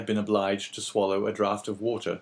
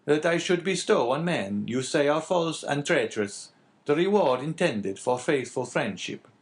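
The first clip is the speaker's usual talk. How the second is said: slightly raised, earnest character voice; lightly voiced abbé; 7 semitones higher, 4 dB louder than usual. Abbé pressing the innkeeper about the old man, lightly voiced. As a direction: lightly play a concerned priest.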